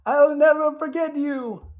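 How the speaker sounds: high, slow mock Italian accent; over-the-top imitation of Fabrizio's accent; hammed-up Fabrizio accent. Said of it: "Fabrizio ruining it with his accent".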